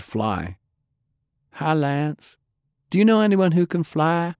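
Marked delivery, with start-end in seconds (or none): 1.5-4.3 put-on higher, girlish voice for Faye; a curious kid asking her friend; young girl Faye.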